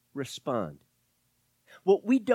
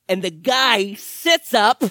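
dramatic reveal; 4 semitones higher, 11 dB louder, slower than usual. Acted. Dramatic resurrection moment.

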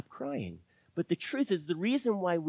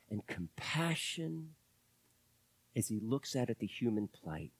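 tender reverence; 3 semitones lower, 8 dB quieter than usual. Acted. Pastor dwelling on Jesus weeping, soft and tender. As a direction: speak it gently, full of compassion.

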